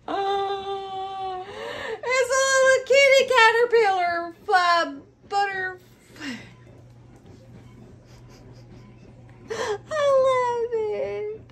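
high, gushing near-tearful coo; overcome with cuteness. Real, not acted. Vlogger falling for the kitty plush, overcome with cuteness. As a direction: coo high, almost crying with delight.

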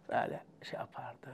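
hushed unvoiced aside; whisper. Real, not acted. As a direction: breathe it out softly. Describing the teacher's gesture quietly.

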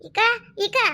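high calling yell to the next room; shouting for her sister. Acted. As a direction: call her urgently, pitched high. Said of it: "தங்கான்னு தலை போற மாதிரி கத்துற?".

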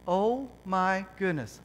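awed. Real, not acted. Putting on the comfy shoe.

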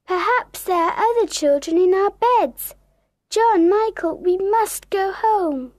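high, worried urging; surprised Wendy. Acted. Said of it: "Wendy is very surprised".

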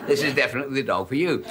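ordinary talk

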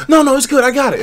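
high, breezy mock barber voice; dismissive barber. Barber brushing off the customer's worries, high and breezy.

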